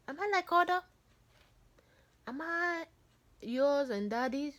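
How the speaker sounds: put-on a small girl's high, hesitant question; timid child asking. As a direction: ask Mom, high and unsure, slowing at the end. Child asking Mom if she's hers.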